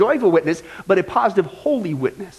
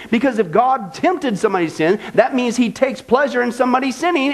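usual speaking voice

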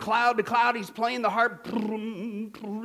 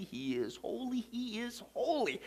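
reverent hush; 13 dB quieter, slower than usual. Acted. Repeated, slower and softer.

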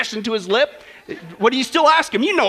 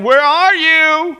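calling out; 5 semitones higher, 7 dB louder, much slower than usual. Acted. God calling to hiding Adam.

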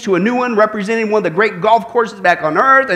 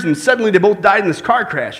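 usual speaking voice

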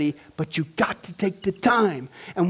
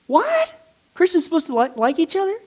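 mock surprise; 10 semitones higher than usual.

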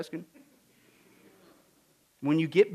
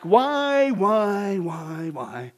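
questioning Job; 4 dB louder, much slower than usual. Acted. Preacher mimics Job asking why, plaintive and fading. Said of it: "after Job began to ask".

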